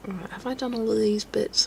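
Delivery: whispered to herself; real whisper while focused on work. Real, not acted. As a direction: murmur the question under your breath. Muttering to herself while painting.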